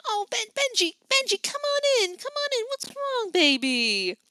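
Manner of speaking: high, fluttering auntie voice; doting aunt calling the boy inside; fussing auntie. Acted. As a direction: fuss over the little boy. Auntie comforts crying boy.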